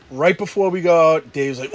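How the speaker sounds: normal talking voice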